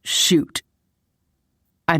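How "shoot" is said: mumbled. A narrator's reading. Man cuddling his girlfriend in bed, mumbled. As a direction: mumble it into someone's hair. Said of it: "kissed the top of my head and mumbled".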